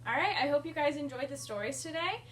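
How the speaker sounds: usual speaking voice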